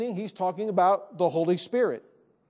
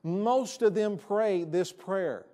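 normal talking voice

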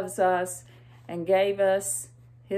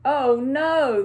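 sad sigh; 5 semitones higher, 6 dB louder than usual. Acted. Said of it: "they sighed sadly".

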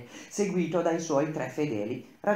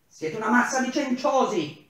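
much higher, louder contemptuous shout; furious boss hurling an insult; 11 semitones higher, 6 dB louder than usual. Acted. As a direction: spit the insult at them. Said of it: "Era furente".

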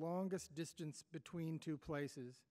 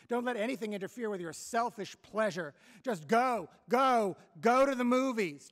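furious mother shouting at her son, louder toward the end; angry mother; 3 semitones higher, 7 dB louder than usual. Acted. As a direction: berate him, building to a yell on go go. Mother scolds son, acted quarrel.

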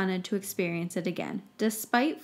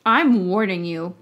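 forceful, louder warning; loud threat; 9 dB louder than usual. A narrator's reading. Minister threatens the professors, loud and forceful. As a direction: bark the warning.

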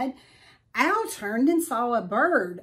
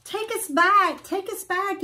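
quacking plea; 9 semitones higher, 4 dB louder than usual. A narrator's reading. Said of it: "Eddie quacked".

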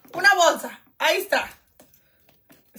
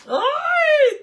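high, loud frustrated yelp; 13 semitones higher, 6 dB louder than usual. Real, not acted. Vlogger tripping over her words, frustrated yelp. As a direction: yelp at getting tongue-tied. Toda trabada.

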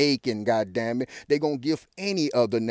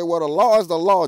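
higher, much louder mocking quote; mock Obama; 5 semitones higher, 8 dB louder than usual. Mocking Obama's excuse to viewers, higher and louder. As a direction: lay on the smug official tone.

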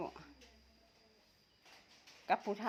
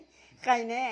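laughing; 5 semitones higher, 4 dB louder, much slower than usual. Acted. Joking quote with laughter.

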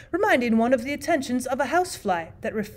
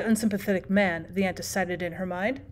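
usual speaking voice

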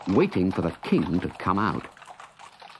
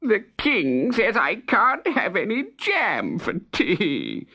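slow, high, tearful complaint; sobbing; 11 semitones higher, slower than usual. Acted. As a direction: wail it like a grown man blubbering. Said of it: "sobbing louder than ever".

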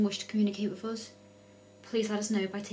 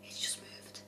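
nervous night vlog whisper; 11 dB quieter than usual. Real, not acted. Tense whisper at Ouija board.

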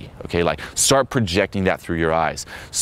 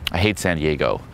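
low, falling mock disapproval; understated acted put-down; deadpan contrarian reply; 4 semitones lower than usual. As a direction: contradict her in a lower voice. His contrarian reply in example.

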